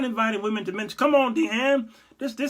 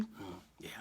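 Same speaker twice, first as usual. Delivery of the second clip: whispered Jesus; 9 semitones lower, 21 dB quieter than usual. Pretend. Jesus answering the phone.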